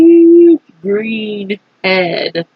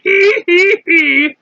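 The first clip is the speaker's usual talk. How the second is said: character giggle; 8 semitones higher than usual. Acted. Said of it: "The centipede kept on grinning".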